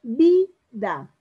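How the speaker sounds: Vida in two beats, VI loud, then da; teacher sounding out VI... da in Vida; stress on VI in Vida. Shown as a demonstration. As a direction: punch VI in Vida, pause before da. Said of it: "marco todo de a partir de ahí".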